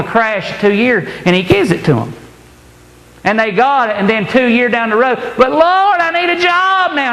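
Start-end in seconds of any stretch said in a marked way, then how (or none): 5.4-7.1 high, pleading whine; whining beggar. Acted. Believer broke after the house, high whining plea to God. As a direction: beg the Lord like a spoiled child.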